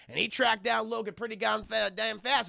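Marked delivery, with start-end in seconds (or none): none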